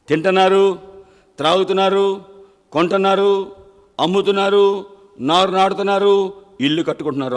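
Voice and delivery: loud preached litany; preaching at full force. Acted. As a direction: hammer each verb home. Preacher repeats the list loudly.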